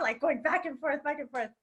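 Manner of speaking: ordinary delivery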